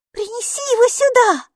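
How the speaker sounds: put-on very high child voice, insistent; little girl's request. A narrator's reading. Maiki asks Kaapo to fetch the elf, high childish request. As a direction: plead for it in a small child's high pitch. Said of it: "попросила Майки".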